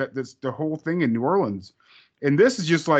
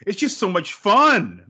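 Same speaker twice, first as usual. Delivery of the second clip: put-on slow, drawn-out chirpy girl voice, a bit louder; cheery daughter; 6 semitones higher, 5 dB louder, slower than usual. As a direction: stretch it out like a clueless happy girl. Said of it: "She's like".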